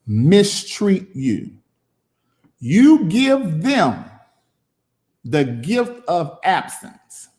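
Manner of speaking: "mistreat you, you give them the gift of absence" drawn out very slowly, each word weighted; slow preached line. Real, not acted. To the viewers, teaching them: slow, weighted preaching. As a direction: land it like a sermon punchline. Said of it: "type that in there".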